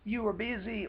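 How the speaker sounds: mock-innocent brush-off, voice up high; a hint of a guilty partner making excuses; foolish excuse. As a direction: lightly imitate a weak dodge. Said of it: "or something foolish like that".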